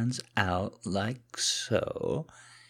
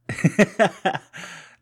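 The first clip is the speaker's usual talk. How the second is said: high, loud falling laugh; scoffing laugh; 13 semitones higher, 10 dB louder than usual. Acted. Laughing at partner claiming to be master, amused. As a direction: laugh it off loudly.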